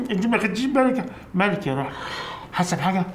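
high, sugary false worry; sweet mock concern; fake concern. Feigned concern after drugging her.

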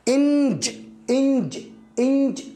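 إنج said high, nasal n into j, repeated; eng sound. Shown as a demonstration. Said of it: "الثلاث الحروف إنج".

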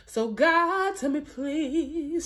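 ordinary talk

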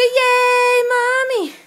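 put-on baby voice. Mom voicing line for baby.

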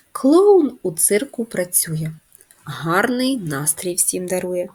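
put-on slow, sing-song verse for children; poem recitation. Teacher reciting a clown poem to first graders, slow and sing-song. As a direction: recite it slowly and brightly to little kids.

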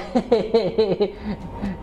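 a shaky laugh to keep from crying; relieved nervous chuckle. Real, not acted. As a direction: laugh off the fear. Nervous laugh during the scary chase.

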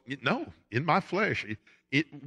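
usual speaking voice

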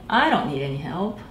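plain reading voice

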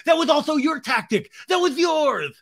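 high, loud accusing voice; hammed-up finger-pointing; mock accusation. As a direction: jab the blame back. Acted quarrel, high accusing.

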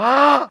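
short, high, loud demo scream; hard scream. Shown as a demonstration. Showing a friend on the phone how to scream in the cinema, loud. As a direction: let out a sudden "Ah!" at full voice. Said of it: "grita durísimo como".

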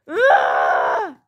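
angry throaty tantrum growl; frustrated growl. Acted. Child's tantrum.

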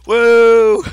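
high loud cheer; excited whoop. Real, not acted. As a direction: whoop with joy. Celebrating three shovels.

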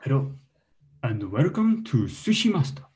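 put-on Japanese TV host; Japanese accent. Shown as a demonstration. Comic opens a sushi show for the crowd, Japanese accent. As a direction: welcome viewers in a mock Japanese accent.

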